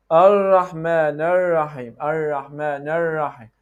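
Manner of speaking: 'Ar-Rahman Ar-Rahim' with long untapped Rs; hanging tongue R. Shown as a demonstration. Teacher imitating the student, R never touching. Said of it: "hang it without any touch".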